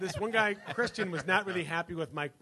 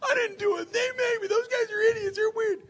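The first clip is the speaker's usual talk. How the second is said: very high, loud panicked shriek; hammed-up frantic wail, pleading innocence; going crazy, imitated; 6 dB louder than usual. Pranksters' stand-in yelling at the angry roommate, hammed-up panic. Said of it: "he goes crazy on him".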